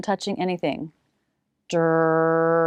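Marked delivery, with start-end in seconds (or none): 1.7-2.6 "Der" held long on a steady low pitch; isolated R sound. Shown as a demonstration. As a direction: sustain the R in "Der", tongue pulled back. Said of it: "the tongue should be pulled back".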